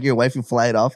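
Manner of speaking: usual speaking voice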